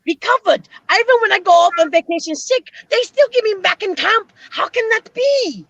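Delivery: put-on comic German accent, slowed on the questions; mock Schultz voice. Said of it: "I used to love that show".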